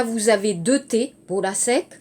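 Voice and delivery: ordinary talk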